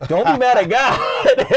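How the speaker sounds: high, loud, laughing through the last words; laughing punchline. Retold or imitated. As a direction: crack up as you deliver it. Trevor Noah quote punchline.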